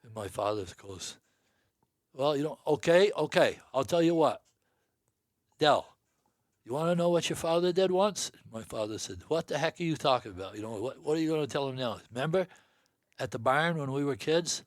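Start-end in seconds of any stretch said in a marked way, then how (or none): 2.1-8.3 raised, animated teasing; lively retold tease. Father loudly teasing his brother. 9.3-14.6 raised, indignant protest; mock protest. Uncle protesting loudly about the old story.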